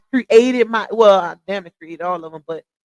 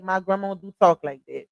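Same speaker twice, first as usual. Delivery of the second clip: ordinary delivery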